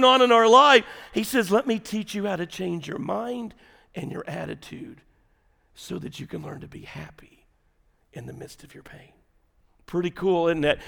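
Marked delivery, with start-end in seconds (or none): 1.5-9.1 hushed, tender teaching. Retold or imitated. Preacher voices Jesus teaching the hurting, hushed. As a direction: drop steadily from soft to a near whisper.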